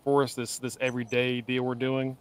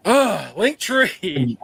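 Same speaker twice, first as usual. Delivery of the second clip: exasperated gasp; 5 semitones higher, 7 dB louder, much slower than usual. Real, not acted. Gasping remembers more links.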